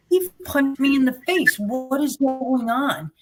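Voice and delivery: high, slow, bewildered outcry. Retold or imitated. Injured woman telling the cop what happened, high bewildered outcry. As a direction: pitch it up high and stretch it out in disbelief. Answering cop, confused on gurney.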